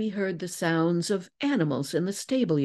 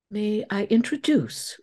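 higher, proudly presenting; prideful; 6 semitones higher, 3 dB louder than usual. A narrator's reading. Lizzie presents the missing man to the deputy, prideful. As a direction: announce it with pride. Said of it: "breaking out with a prideful laugh".

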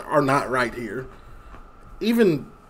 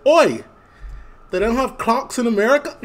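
loud, high indignant heckle; mock British street shout; British homeless man; 8 semitones higher, 5 dB louder than usual. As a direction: call out "Oi" like an affronted Brit. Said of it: "a homeless man saw me looking".

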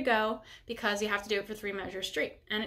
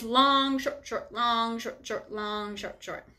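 "long" leaned on, "short, short" lighter and quicker, fading at the end; counting out a rhythm in threes; much slower than usual. Shown as a demonstration. Teacher slowly counting the rhythm for students, a rhythmic chant. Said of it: "So listen very carefully".

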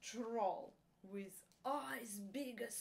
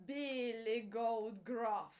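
usual speaking voice

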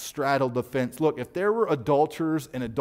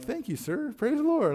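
stunned thanks; 10 semitones higher than usual. Retold or imitated. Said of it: "I was like".

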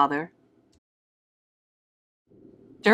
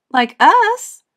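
high, louder proud monster mom; proud mother voice; 10 semitones higher, 6 dB louder than usual. A narrator's reading. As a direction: say it with proud glee.

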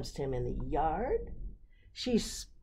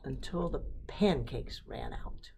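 ordinary delivery

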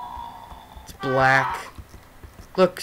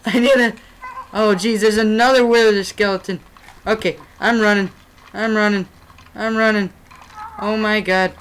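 loud high frantic gamer panic; running for your life, yelling; panicked; 4 semitones higher, 4 dB louder, faster than usual. Real, not acted. Chased by skeletons, panicking.

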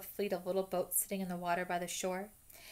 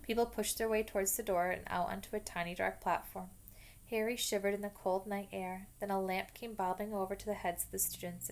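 usual speaking voice

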